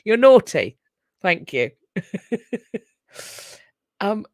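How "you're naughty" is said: loud playful scolding; mock-scolding; teasing. Real, not acted. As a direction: tease them for being cheeky. Teasing chat commenter.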